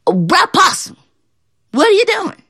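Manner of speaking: high, sly rabbit calling out; Brer Rabbit voice. Acted. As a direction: pitch it way up, curious. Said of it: "He said".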